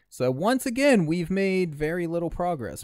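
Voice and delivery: exasperated. Real, not acted. Exasperated summary of failure.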